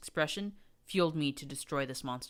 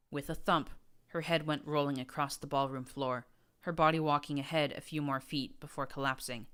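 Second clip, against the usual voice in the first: plain reading voice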